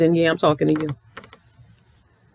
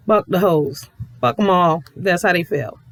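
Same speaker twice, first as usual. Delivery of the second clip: normal talking voice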